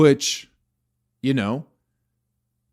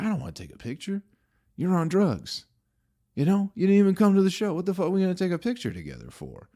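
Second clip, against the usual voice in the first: exasperated refusal; 10 semitones higher, faster than usual. Retold or imitated.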